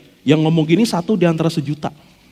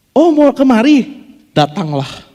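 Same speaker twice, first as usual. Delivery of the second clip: casual God; 7 semitones higher, slower than usual. Acted. Preacher voicing God's casual invitation, breezy and higher. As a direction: toss it off lightly, like it's nothing. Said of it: "Jawaban Tuhan ngomongnya begini".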